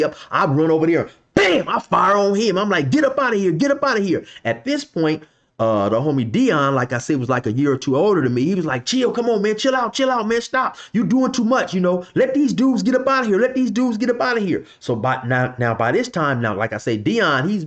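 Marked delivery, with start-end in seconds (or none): 2.9-4.2 raised, higher-pitched barked order; angry order. Retold or imitated. Narrator yelling at the driver. 8.9-14.6 higher voice of a friend urging calm; Dion, calming. Retold or imitated.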